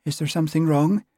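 high, lilting island voice of concern; soft Western Isles lilt. A narrator's reading. Constable checking on blushing sergeant, soft Western Isles lilt. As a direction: ask with a gentle Hebridean lilt. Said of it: "in a soft voice delicately laced with an endearing lilt".

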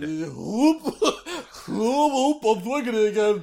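high goofy dog voice; squeaky, silly sword dog voice; Zacian impression. Sword swinging sounds in character.

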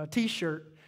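ordinary talk

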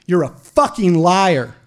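slow, loud angry bark as the officer; spitting accusation. Retold or imitated. Officer spits accusation at handcuffed man, angry bark. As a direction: act out an officer barking, drawing the words out. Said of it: "he spits at me".

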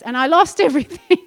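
laughing while telling it. Real, not acted. Preacher laughing at her own story of losing everything. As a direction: break into laughter through the words. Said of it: "Mum's laughing at me".

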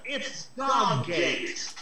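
drawn-out mock announcement; hammed-up headline voice; slow mock headline. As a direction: stretch it like a scandal headline.

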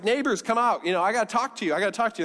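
high, urgent call to the neighbors; mild mock yell, high-pitched; acted yelling. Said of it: "she starts yelling".

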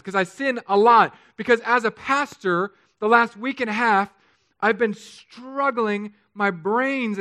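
high, forceful sermon delivery; impassioned preaching. Real, not acted. Pastor confesses his struggle to congregation, impassioned preaching. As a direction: preach with mounting urgency.

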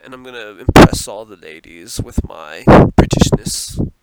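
loud, hammed-up British accent. Shown as a demonstration. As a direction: show off a mock British accent. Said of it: "British accent".

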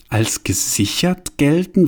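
slow, scornful repeat of als gesichert gelten; mocking echo. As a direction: throw his phrase back at him slowly. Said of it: "Was soll das bedeuten?". (spöttisch nachgeäfft)

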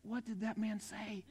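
soft, slow, bewildered aside; confused young bride murmuring after her father-in-law leaves; hushed puzzled question. Retold or imitated. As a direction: ask it quietly, lost in translation. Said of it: "she said".

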